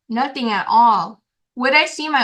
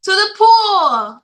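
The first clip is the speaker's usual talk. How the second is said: loud, higher, slow yell; 9 semitones higher, 7 dB louder, slower than usual. Acted. Boy echoing the firemen, slow loud yell. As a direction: shout back the answer. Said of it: "I yelled".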